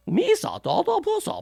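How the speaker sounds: high, soft alien babble; Jar Jar Binks. Acted. Dismissively imitating an alien's weird accent in high nonsense babble. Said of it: "Jar Jar was an alien in, in, in some weird accent".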